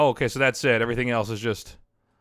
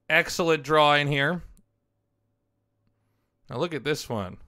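ordinary talk

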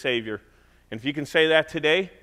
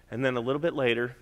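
usual speaking voice

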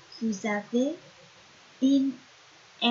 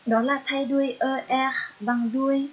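ordinary talk, nothing marked

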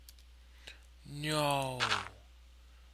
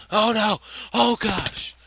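high loud panicked shriek; 12 semitones higher, 11 dB louder, much slower than usual. Real, not acted. Gamer seeing lava, high panicked shriek. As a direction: shriek in real alarm.

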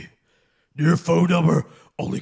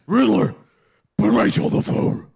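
demanding Batman; 8 semitones higher than usual. Acted.

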